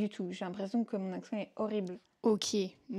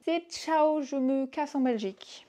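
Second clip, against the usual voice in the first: higher, louder breezy goodbye; flippant ciao; 5 semitones higher, 4 dB louder than usual. Real, not acted. Joking she'll fly home to Belgium, breezy and loud. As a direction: toss it off with a shrug. Deadline, else back home.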